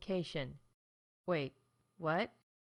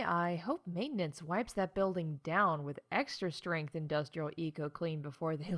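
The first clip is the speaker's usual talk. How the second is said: ordinary talk, nothing marked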